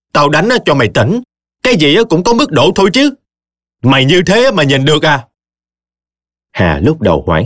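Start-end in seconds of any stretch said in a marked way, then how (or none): 0.1-5.3 angry scolding. Acted. Mother-in-law scolding Tấm.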